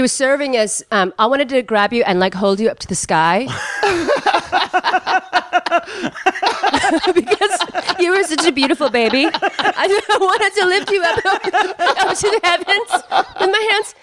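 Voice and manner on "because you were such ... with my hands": high, gushing laughter through the words; laughing. Real, not acted. Gushing about beautiful baby.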